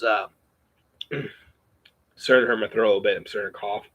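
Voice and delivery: ordinary talk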